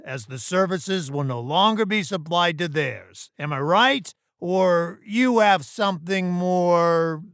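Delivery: loud, high, imperious voice; condescending boss. Acted. Boss dismissing an employee's objection, condescending and loud.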